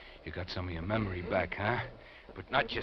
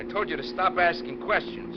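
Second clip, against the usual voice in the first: higher and louder, out of patience; angry shout; 13 semitones higher, 9 dB louder than usual. Acted. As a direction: bark the order at him. Kidnapper losing patience.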